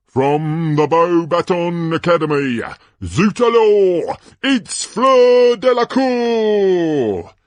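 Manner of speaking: high, loud, stretched-out name; grand announcer introduction. Acted. Announcer presenting the Beauxbatons champion, booming and drawn out. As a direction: trumpet the champion's name to the stands.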